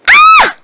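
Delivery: high, very loud scream; everyone's shriek at the danger; terrified scream. A narrator's reading. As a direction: scream out in sudden fright. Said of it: "We all screamed".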